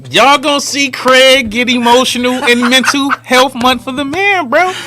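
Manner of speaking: mock announcer voice teasing Craig; hype announcer. Teasing host announcing Craig's tears.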